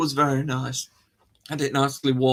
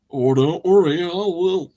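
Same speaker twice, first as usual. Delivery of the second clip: loud put-on animal accent; animal in mock accent; 4 semitones higher, 6 dB louder than usual. Animal accepting the presenter's offer, loud mock-accent reply. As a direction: answer as a hungry animal in a silly accent. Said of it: "I don't know why I did the accent".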